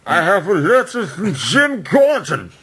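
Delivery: slow, high, laughing hero proclamation; mock Batman announcement. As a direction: announce it like a masked hero, giggling. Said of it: "because he's yelling, but he's wearing a mask".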